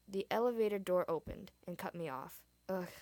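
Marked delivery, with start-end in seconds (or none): none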